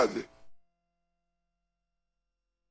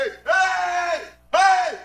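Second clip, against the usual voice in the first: shout. Retold or imitated. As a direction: yell out across the church. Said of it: "e gritei". (grito alto chamando)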